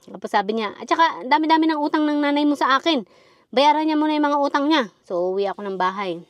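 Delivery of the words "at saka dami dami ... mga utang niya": higher, louder shopkeeper scolding; retold angry scolding. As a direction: scold the kid about the mother's debts, raised. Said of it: "si Mang Andoy magagalit".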